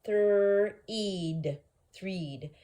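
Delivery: "Threed" drawn out loud with a long ee; long vowel try. Shown as a demonstration. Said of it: "let's try the long sound first".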